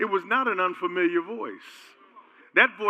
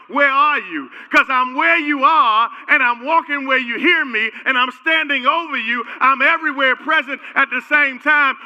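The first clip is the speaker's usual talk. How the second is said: louder, higher booming call; God calling out; 7 semitones higher, 9 dB louder than usual. Retold or imitated. God calling to Adam in the garden, loud booming call. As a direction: call out to the hiding man.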